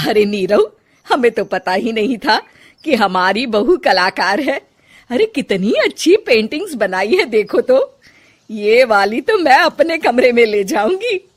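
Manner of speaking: put-on loud, admiring older woman; delighted mother-in-law. Mother-in-law praises her bahu's paintings to her son, loud and delighted. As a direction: gush over the paintings.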